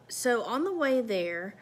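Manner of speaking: ordinary talk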